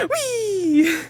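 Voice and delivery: high, drawn-out whee; playful whee. Pretend. Player flying about as a bird, playful high whee. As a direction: squeal it as if taking flight.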